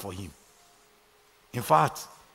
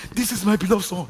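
a proud father's boast, pitched higher; a proud father mildly boasting about his son; bragging proud father; 7 semitones higher, 7 dB louder than usual. Retold or imitated. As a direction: show off your son, a bit higher and louder. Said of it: "He was bragging".

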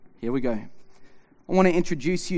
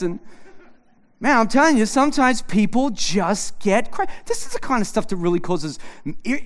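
ordinary talk

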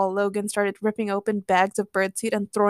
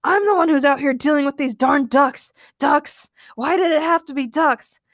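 exasperated, higher rant; raised, fed-up complaint; shouted; 5 semitones higher, 4 dB louder than usual. A narrator's reading. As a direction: gripe loudly about the ducks. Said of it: "shouted Logan".

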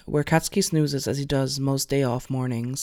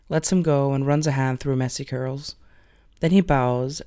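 usual speaking voice

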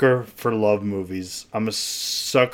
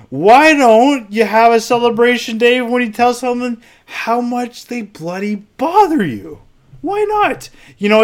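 fired-up rant; 11 semitones higher, 3 dB louder than usual. Real, not acted. Pitching a mock holiday, fired up.

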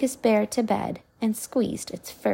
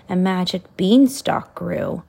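ordinary delivery

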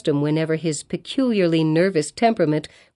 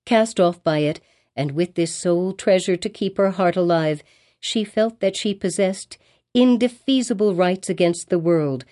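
usual speaking voice

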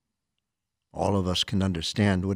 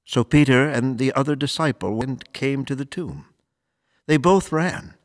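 measured, formal scripture reading; solemn gospel reading; 4 semitones higher, 5 dB louder than usual. Acted. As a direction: read it like a priest at the ambo. Priest reads gospel aloud.

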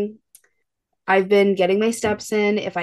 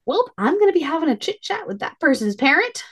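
mock mama bear threat; 8 semitones higher than usual. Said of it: "I'm like".

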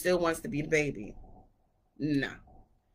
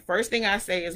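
usual speaking voice

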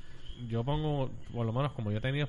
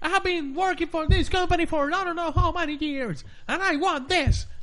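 put-on American customer accent; 5 dB louder than usual. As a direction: mimic an angry American caller, high-pitched. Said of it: "para que sepan más o menos el acento, el tono".

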